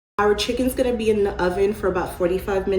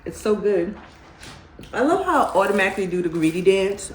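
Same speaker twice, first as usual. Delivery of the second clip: ordinary talk, nothing marked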